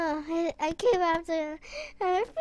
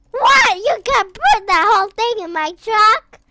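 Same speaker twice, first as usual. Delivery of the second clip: amazed; 4 semitones higher, 11 dB louder than usual. Real, not acted. Amazed friend fits whole load.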